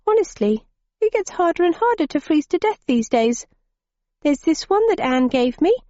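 put-on high, huffy girlish complaint. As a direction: sulk like a put-out young girl. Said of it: "she continued in a huffy tone".

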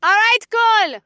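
high called-out praise; shouted call. A narrator's reading. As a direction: call it up to the sky. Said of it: "Professor Olivia shouted from below".

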